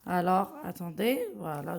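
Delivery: ordinary delivery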